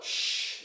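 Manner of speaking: long voiceless "sh"; sibilant sh sound. Shown as a demonstration. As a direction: sustain the sh by itself. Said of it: "the sibilant".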